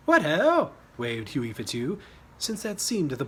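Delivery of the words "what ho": high, loud jolly greeting; cheery hail. Acted. Hughie waving politely at the enemy, high loud hail. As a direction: call out a hearty hello.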